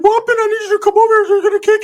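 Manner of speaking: high cartoon mouse voice; Mickey Mouse voice. Cousin Ricky calling him over, in high cartoon mouse voice. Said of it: "in the fucking Mickey Mouse voice".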